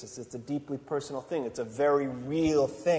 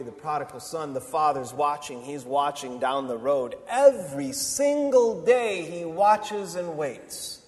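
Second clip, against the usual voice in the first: normal talking voice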